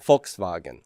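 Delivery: German "Volkswagen", F then V; German pronunciation. Shown as a demonstration. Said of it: "In German, you would pronounce that".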